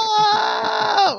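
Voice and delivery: shrieking No! very high-pitched; mock heart scream. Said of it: "My heart screamed".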